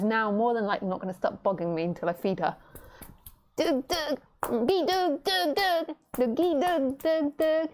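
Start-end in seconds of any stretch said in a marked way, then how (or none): 3.6-7.7 high chirpy nonsense syllables; bird chatter talk. Acted.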